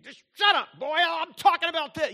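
put-on loud, high shout at the boy; dad shouting down his son's comeback; angry dad shout. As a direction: snap him silent like an angry dad. Dad snapping at son.

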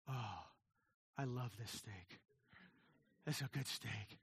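low, soft, satisfied relish; savoring a steak. Retold or imitated. A diner relishes his steak in a low, pleased voice. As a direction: savor each word like the steak. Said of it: "And I'll go".

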